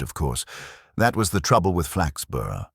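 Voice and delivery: usual speaking voice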